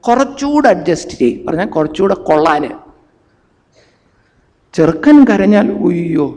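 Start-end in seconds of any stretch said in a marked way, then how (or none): 0.0-1.4 mother's advice, mocked. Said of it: "അമ്മ പറയും".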